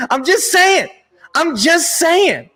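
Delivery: teasing high-pitched I'm just saying; high teasing repeat. Real, not acted. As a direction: sing it up high to needle.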